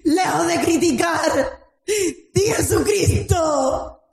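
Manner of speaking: full-force pulpit preaching; fiery preaching. Acted. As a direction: preach it out to the whole church.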